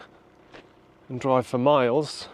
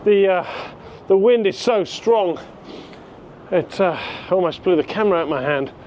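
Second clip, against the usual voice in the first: raised voice against strong wind; shouting over wind; 8 semitones higher, 5 dB louder than usual. Real, not acted. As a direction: call out over the gusts. Windy outdoors, camera nearly blown.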